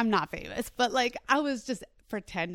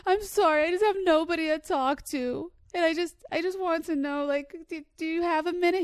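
higher, louder whiny fake sob voice; fake crying; 8 semitones higher, 5 dB louder than usual. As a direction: play the pitiful victim, whimpering for sympathy. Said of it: "I'm acting like I'm crying".

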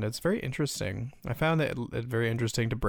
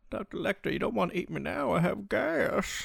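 high-pitched, hammed-up pleading; mock Clarice plea; 9 semitones higher than usual. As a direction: beg off in a silly high voice. Improvised Clarice pleading with Lecter.

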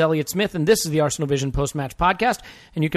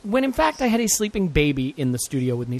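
ordinary delivery

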